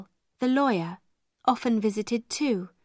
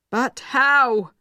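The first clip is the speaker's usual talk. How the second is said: despairing cry; 3 semitones higher, 6 dB louder than usual. Acted. Carrisford's despairing question.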